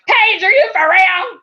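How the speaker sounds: high, loud disbelieving yell; mock incredulous shout. Friends on the phone doubting her, mock shout. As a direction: call out in shocked disbelief. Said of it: "everybody I called was like".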